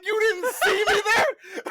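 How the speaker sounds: high, slow, laughing yell; yelling in the street. Retold or imitated. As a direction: drag it out in a high mock-angry yell while laughing. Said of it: "I'm yelling in the street".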